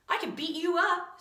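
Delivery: high, loud schoolkid threat; angry threat. Acted. Insulted pupil threatens the classmate who mocked him, angry. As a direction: flare up and challenge them to a fight.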